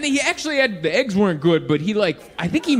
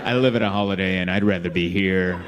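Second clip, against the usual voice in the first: lower, offhand, unbothered reply; lazy shrug; 10 semitones lower, 4 dB quieter than usual. Retold or imitated. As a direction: brush it off, nonchalant. Guest answers his boss.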